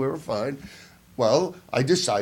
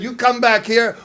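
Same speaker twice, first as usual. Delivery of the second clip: put-on a touch of New Jersey accent, higher; 8 semitones higher, 5 dB louder than usual. Aliens talking like New Jersey guys, higher and louder. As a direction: tough New Jersey guy. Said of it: "they talked almost like this".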